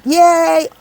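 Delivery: high, drawn-out yay; little sung-out celebratory whoop; demonstrated cheer. Shown as a demonstration. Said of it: "and I do".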